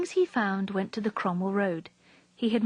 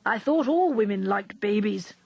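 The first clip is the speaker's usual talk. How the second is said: put-on protesting old man; 3 dB louder than usual. A narrator's reading. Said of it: "Gum protested".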